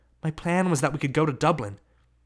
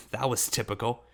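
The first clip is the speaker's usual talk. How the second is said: ordinary delivery, nothing marked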